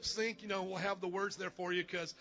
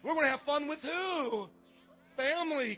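loud, high, rousing call to the crowd; hyping the crowd; 6 semitones higher, 5 dB louder than usual. Real, not acted. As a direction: pump up the congregation. Pastor hypes crowd, call-response.

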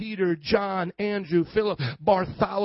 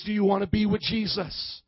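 ordinary talk

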